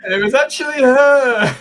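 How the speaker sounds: slow, high and loud reveal; milk the twist like a trailer; mock dramatic reveal.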